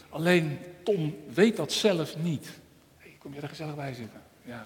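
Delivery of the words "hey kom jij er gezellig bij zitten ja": friendly aside. Real, not acted. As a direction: welcome the little one quietly. Aside to a child joining. (zacht en vlot terzijde tegen een kind)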